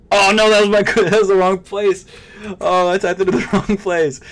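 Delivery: laughing panic. Real, not acted. Typed card number in wrong place.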